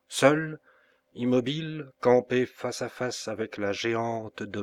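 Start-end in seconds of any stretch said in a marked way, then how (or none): none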